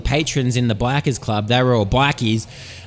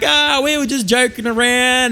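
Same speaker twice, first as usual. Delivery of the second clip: high playful voice; 10 semitones higher than usual. Acted. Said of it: "They were all like".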